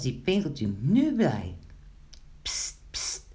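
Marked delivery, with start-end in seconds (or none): 2.4-3.3 whispered attention-getter; hushed psst. Acted. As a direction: hiss softly to a friend across the room. Secretly calling Wiebelstaartje.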